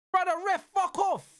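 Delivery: high, angry outburst at the ref; real frustration aimed at the ref; telling off the ref. Real, not acted. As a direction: let the ref have it. Yelling at the referee on stream.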